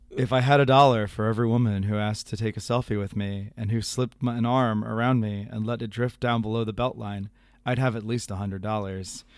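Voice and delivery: low, measured radio host impression; Garrison Keillor. Host reading the email about selfies, Keillor impression. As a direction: deliver it as a wry folksy monologue. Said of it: "do my appropriate, uh, Garrison Keillor voice".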